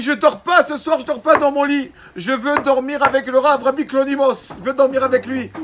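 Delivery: put-on high, loud child's insisting wail; a stubborn little boy demanding his way, loud and high; whiny child. The five-year-old boy tells his mother, loud and high, that he'll only sleep with the rabbi.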